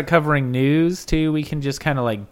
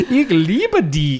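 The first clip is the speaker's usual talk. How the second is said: slow, drawn-out mock German, a bit louder; playful German love line; hammed-up German; 5 semitones higher, 5 dB louder, slower than usual. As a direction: camp it up in a fake German lilt. Mock German after town shout-out.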